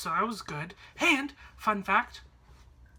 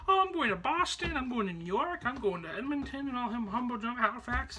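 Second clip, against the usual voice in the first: higher, sing-song crowd chatter; chattering travelers; 4 semitones higher than usual. Retold or imitated. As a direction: imitate fellow passengers listing destinations. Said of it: "people were talking like".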